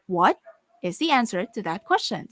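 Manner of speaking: "What" set apart with a rising tune, then a pause; varied intonation on parts. Shown as a demonstration. Teacher changing intonation on parts of a sentence, varied intonation. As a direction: lift "What" as its own question, pause, then go on. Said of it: "some intonation of that parts of that sentence".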